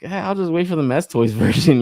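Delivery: cheeky imitated buyer, chuckling; laughing mock shrug. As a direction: brush it off like a bargain hunter. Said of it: "It's like".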